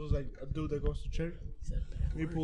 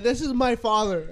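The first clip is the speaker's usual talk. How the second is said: louder, higher, slow introduction; imitated priest; 5 semitones higher, 8 dB louder, slower than usual. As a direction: present him grandly in a mock priest's voice. Voicing the priest in a story.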